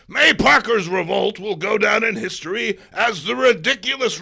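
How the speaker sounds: loud, high gloating editor's rant; a newspaper boss shouting at his photographer. Acted. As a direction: bellow with greedy glee. Jameson reading quote, yelling.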